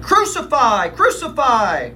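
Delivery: high, loud chant, slowing down; crowd crying out. Acted. Chief priests demanding death from the governor, high loud cries. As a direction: cry it out like a mob.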